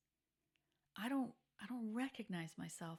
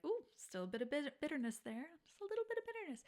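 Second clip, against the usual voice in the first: teasing sing-song; 8 semitones higher, faster than usual. Retold or imitated. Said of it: "I'm like".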